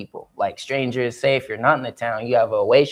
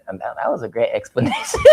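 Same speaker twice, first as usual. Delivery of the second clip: ordinary delivery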